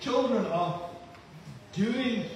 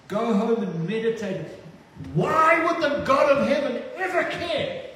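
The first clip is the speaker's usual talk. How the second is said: raised, pleading preacher's voice, climbing higher; preaching at full force; 5 semitones higher than usual. Acted. Pastor urging the congregation about God's joy, preaching with rising force. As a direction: preach it to the back row.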